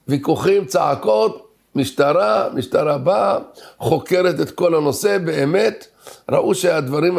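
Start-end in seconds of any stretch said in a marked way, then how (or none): none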